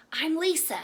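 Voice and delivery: a hint of a happy little girl; lightly voiced girl. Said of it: "she said".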